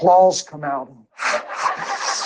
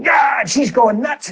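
excited telling; 6 semitones higher than usual. Real, not acted. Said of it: "excited retelling".

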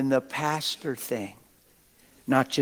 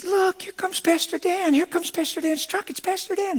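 much higher, slowed, delighted mother spotting the truck; excited mother. Retold or imitated. Pastor imitating a single mom pointing out his truck, high and thrilled. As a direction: squeal it out like a thrilled mom at the window. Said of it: "she said".

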